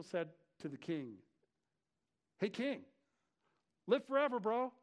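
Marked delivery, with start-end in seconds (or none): 2.4-4.7 casual hail. Acted. Preacher voices Daniel greeting king casually.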